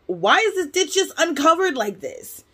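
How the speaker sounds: high-pitched incredulous complaint; retold disbelief. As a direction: exclaim it in disbelief, stretching the key words. Retold outraged reaction to ditch.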